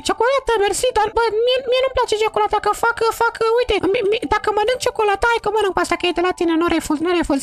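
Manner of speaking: put-on high falsetto girl voice; high put-on girl voice scolding her old friend. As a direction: play the offended girl in a high voice. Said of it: "trebuie să fac vocea".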